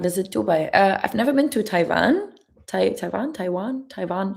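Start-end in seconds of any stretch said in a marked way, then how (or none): 2.9-3.3 Taiwan with a German V sound; German pronunciation. Shown as a demonstration. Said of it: "in German you say Taiwan". 3.3-3.9 Taiwan with a rounded English W; English pronunciation. Shown as a demonstration. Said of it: "in English you ta-, say Taiwan". 3.9-4.3 Taiwan with a German V sound; German pronunciation. Shown as a demonstration. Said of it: "in German you say Taiwan".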